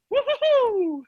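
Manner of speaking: high whooping cheer; excited whoop. Acted. Cheering the fun.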